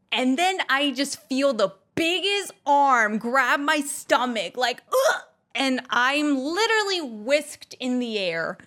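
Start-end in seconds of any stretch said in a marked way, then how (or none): 4.9-5.2 grunt when grabbed. Retold or imitated. Grabbed by security guard.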